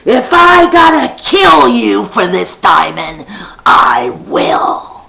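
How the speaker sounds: loud menacing yell; a thief shouting a death threat; threatening shout. Acted. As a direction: threaten him with real menace. Sammy threatens Frankie.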